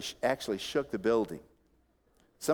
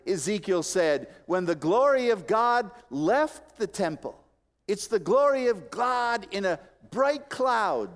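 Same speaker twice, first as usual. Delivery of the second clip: raised, ringing pulpit delivery; impassioned preaching; 9 semitones higher, 4 dB louder than usual. Real, not acted. Preacher telling the congregation the glory cloud returns, impassioned. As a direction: preach it with force, pitch climbing.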